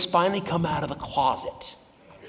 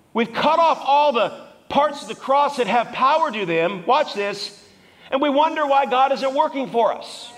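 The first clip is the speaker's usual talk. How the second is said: high, driving preacher's voice; full-force preaching; 8 semitones higher than usual. Real, not acted. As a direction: preach it hard, pitched up and urgent.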